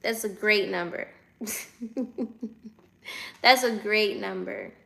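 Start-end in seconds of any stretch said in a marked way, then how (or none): 1.4-2.7 soft laugh. Real, not acted. Laughs at her viewer count.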